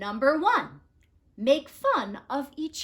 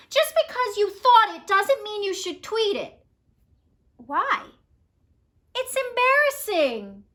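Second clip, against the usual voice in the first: angry scolding; 10 semitones higher, 7 dB louder than usual. Acted. Vulture scolds Birdie.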